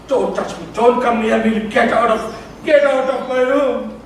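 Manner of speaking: loud shouts ordering someone away; imitated hysterical shouting; frightened shouting. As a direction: shout it out, reliving her panic. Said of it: "She started shouting".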